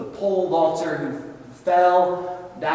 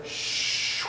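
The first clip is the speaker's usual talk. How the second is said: long soft shushing whoosh; imitated banister slide; 8 dB quieter than usual. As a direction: voice the sound of a slide.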